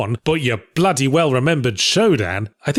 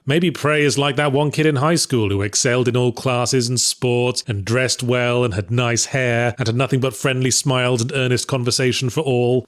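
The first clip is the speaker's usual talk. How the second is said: ordinary talk, nothing marked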